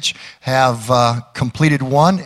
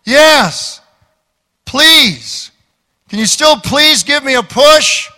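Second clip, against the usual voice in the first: put-on higher, pleading drunk voice from the dark; 9 semitones higher, 3 dB louder than usual. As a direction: beg like a tipsy stranger out of sight. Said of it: "he heard a voice say".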